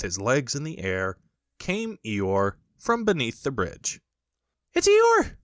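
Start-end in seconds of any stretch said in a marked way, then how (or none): 4.7-5.4 very high, loud little kangaroo cry; high squeaky baby kangaroo; excited Roo cry. A narrator's reading. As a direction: squeal it out like a tiny excited joey. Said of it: "cried Roo, terribly excited".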